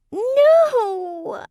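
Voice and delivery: very high, loud nasal shriek. A narrator's reading. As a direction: shriek in panic, two octaves up. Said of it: "the woman shrieked".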